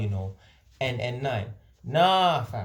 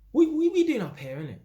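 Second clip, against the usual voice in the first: much higher, baffled stammering question; mock the stranger's bafflement; puzzled local man; 9 semitones higher than usual. Retelling a local man asking why he's here, mock baffled.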